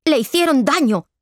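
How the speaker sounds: indignant outburst, pitch leaping up. A narrator's reading. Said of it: "dijo Ángel en voz alta".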